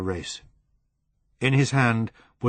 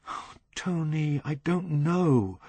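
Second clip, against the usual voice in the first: 1 tearful hesitation; 6 semitones higher, slower than usual. Acted. Helen torn and tearful.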